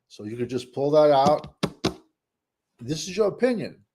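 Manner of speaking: normal talking voice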